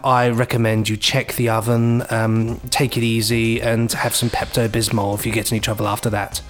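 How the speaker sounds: ordinary talk, nothing marked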